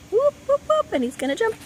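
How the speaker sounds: high whooping cheer; excited cheer. Real, not acted. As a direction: whoop him on, high and bright. Cheering dog jump.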